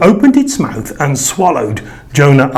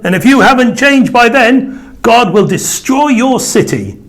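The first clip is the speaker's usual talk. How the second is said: stern warning; 10 semitones higher than usual. A narrator's reading. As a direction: warn the whole city sternly. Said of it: "he warned them".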